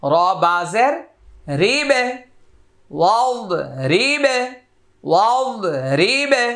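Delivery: Quranic spelling drill. Shown as a demonstration. Teacher modelling Arabic syllables for students to repeat, measured drill chant. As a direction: spell it out, then sound it in a lilting model voice.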